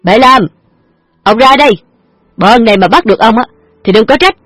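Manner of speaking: thug yelling. A narrator's reading. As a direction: bellow it down the alley as a gangster. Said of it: "hét váng lên".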